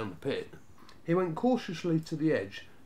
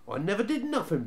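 higher, whiny protest of innocence; protesting boy; 7 semitones higher, faster than usual. A narrator's reading. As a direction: plead you did nothing wrong. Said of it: "protested the youngest Snarget".

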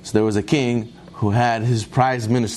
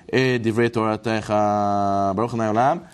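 slow, lower, drawn-out blessing; prayer closing; 5 semitones lower than usual. Real, not acted. Speaker closing the lesson with amen, intoned prayer. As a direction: intone the Hebrew low and stretch the last words.

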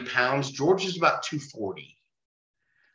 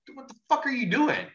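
high-pitched, quick disbelief; retold incredulous challenge; 13 semitones higher, faster than usual. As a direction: demand it fast and high, in disbelief. Retold alarmed challenge to chair-wielder.

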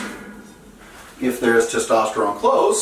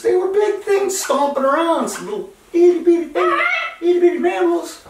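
very high, squeaky little voice; squeaky tiny voice. Acted. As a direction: squeak like a tiny scared mammal. Acting out tiny scared mammals.

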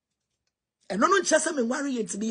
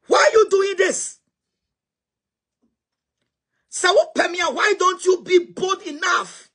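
raised-voice challenge; 5 semitones higher than usual. Real, not acted. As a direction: call them out, pitched high and insistent. Confronting the people she addresses.

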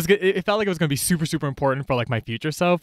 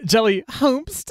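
ordinary delivery

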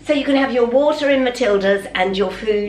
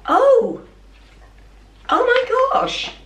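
surprised gasp; 12 semitones higher, slower than usual. Real, not acted. Unboxing a gift, she gasps in high surprise. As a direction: caught off guard by the parcel, voice leaping up.

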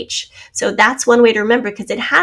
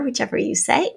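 ordinary delivery

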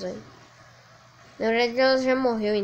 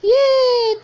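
normal talking voice

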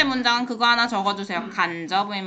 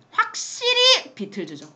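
playing the guest; 12 semitones higher, 3 dB louder than usual. Voicing Mr Smith's answer.

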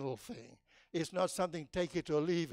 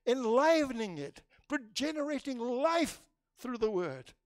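loud, raised sermon voice with fervent lifts; preaching at full force; 7 semitones higher, 4 dB louder than usual. Acted. Preacher rousing the congregation about joy in God, full-force preaching.

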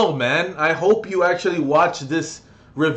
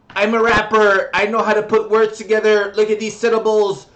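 high, stiff parody of a soulless rapper; mock him like a proud robot; monotone robot boasting, pitched up; 3 semitones higher, 3 dB louder than usual. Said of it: "I am a robot".

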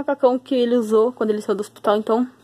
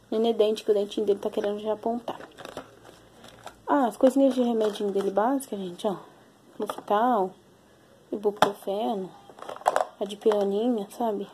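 speaking softly; 2 semitones lower, 6 dB quieter than usual. Real, not acted. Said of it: "Tô falando baixo porque ele tá aqui".

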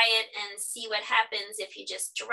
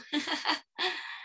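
soft, high giggle; light laugh; 5 semitones higher, 4 dB quieter than usual. Real, not acted. Teacher laughing at the story's funny end, soft giggle. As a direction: let a little giggle out.

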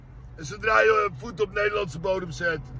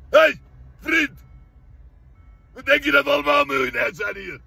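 higher-pitched, indignant challenge; indignant protest; 5 semitones higher than usual. Acted. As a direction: call him out over the cost, pitched up. Protest tegen de dure reis. (verontwaardigd protest, hoger van toon)

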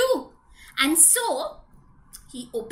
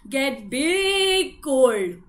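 big voice; 4 dB louder, much slower than usual. Acted. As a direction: make it sound huge and heavy.

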